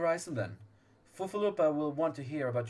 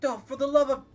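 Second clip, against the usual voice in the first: loud, high exasperated cry; exasperated outburst; 11 semitones higher, 10 dB louder, faster than usual. Acted. Voicing a fed-up commander: exasperated outburst. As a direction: throw up your hands in exasperation.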